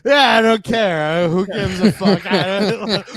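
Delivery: loud, laughing mock indifference; mocking the co-host. Co-host teases host about clicking around online, mocking indifference. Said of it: "you clicking around the internet".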